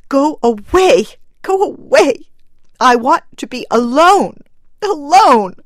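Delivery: high, loud, grief-stricken cries; anguished outburst. A narrator's reading. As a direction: drive them away with a cry of raw sadness. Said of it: "she cried out in a release of unreasoning sadness".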